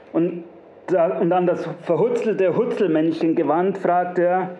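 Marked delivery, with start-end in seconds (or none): none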